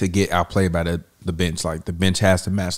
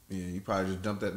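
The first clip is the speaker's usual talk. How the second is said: normal talking voice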